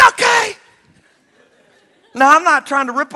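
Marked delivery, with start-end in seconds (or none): 0.1-0.5 loud eager okay. Acted. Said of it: "Pete's like".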